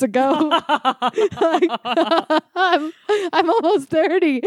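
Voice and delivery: high laughing talk; laughing at herself. Real, not acted. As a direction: giggle through the words. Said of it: "laughs heard".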